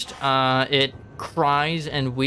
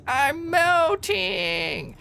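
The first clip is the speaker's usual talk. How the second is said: high, slow dying hag cry; sea hag wail; much slower than usual. Acted. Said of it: "as the sea hag says".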